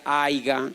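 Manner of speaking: Aiga said for haya, rustic country form; country speech. Retold or imitated. Mimicking his own peasant speech as a boy, rustic pronunciation. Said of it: "campesinísimo".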